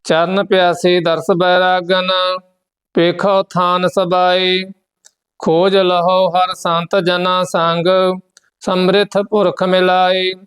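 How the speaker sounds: steady, measured chant of the verses; scripture recitation. Acted. Teacher recites Gurbani verses for the students, steady and measured. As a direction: recite each line evenly, with devotion.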